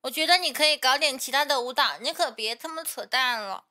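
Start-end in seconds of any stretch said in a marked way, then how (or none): none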